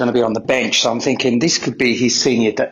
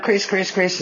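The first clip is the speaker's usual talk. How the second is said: retold calling out; 7 semitones higher, slower than usual. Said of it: "And I'm shouting out".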